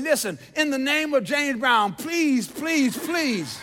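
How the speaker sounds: hammed-up, urgent begging; loud pleading. Blind beggar pleading with passersby, loud and urgent. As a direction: beg loudly, each plea on its own. Blind man begs to be told.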